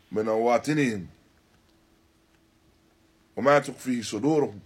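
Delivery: ordinary talk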